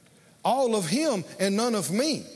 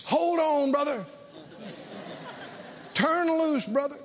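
urging altar helper; 5 semitones higher than usual. Retold or imitated. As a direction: call it out like an eager brother at the altar. Retold altar helpers urging him on.

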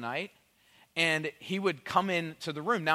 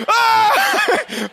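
high, full-volume comic scream; terrified scream; 13 dB louder than usual. Retold or imitated. Dad startled by toddler at bedside, shrieking. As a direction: scream as if jumped in the dark.

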